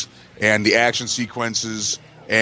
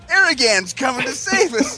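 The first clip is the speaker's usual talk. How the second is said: high, slow mock hero voice; mock heroic cry; 13 semitones higher than usual. Podcast hosts goofing on Lord of the Rings, high slow mock cry. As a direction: cry it out like a hobbit saved.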